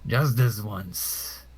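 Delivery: very slow, voiced aloud; deliberate, drawn-out warning; firm concession. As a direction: draw out each word for the opponent. Then repeated aloud to opponents.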